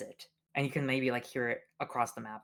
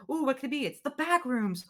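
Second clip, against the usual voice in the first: teasing hype; 10 semitones higher than usual. Retold or imitated. Acting fans teased.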